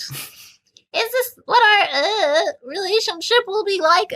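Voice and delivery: high, slow, tearful Anna voice; hammed-up weepy question; mock whimper. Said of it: "I whisper".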